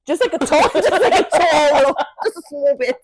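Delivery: real laughter breaking up the line; laughing. Real, not acted. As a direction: giggle out the request. Joking about wanting slight racism.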